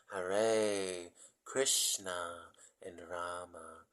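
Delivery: very slow, each syllable stretched; really slowly. Shown as a demonstration. Teaching dear souls the names, said really slowly. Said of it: "Maybe I'll say that again".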